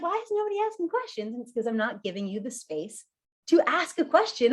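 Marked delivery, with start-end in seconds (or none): 0.0-1.3 high, soft baffled ask; host puzzled by a silent room, high and baffled; puzzled whine. Pretend. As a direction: whine it up high in mock confusion. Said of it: "I'm gonna be like".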